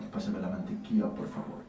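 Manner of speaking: breathy whispered Spanish, close in the ear; seductive whisper. Retold or imitated. Friend whispers the phrase into my ear, seductive whisper.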